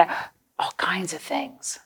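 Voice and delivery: trailing off into a whisper. Acted. Teacher listing vehicles to children, fading to a whisper. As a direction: hush the list down to nothing.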